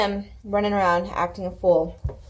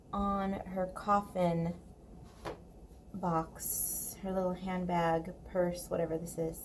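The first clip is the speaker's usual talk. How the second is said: much softer, half to herself; quiet murmur; 8 dB quieter than usual. Real, not acted. Muttering to herself while painting gold, much softer. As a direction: murmur while working close to the page.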